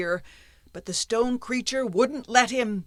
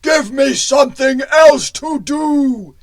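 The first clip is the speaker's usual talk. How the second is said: slow, loud stone golem; menacing golem; 6 semitones higher, 13 dB louder, slower than usual. Acted. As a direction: boom it out slowly like a stubborn giant. Golem shakes apprentice.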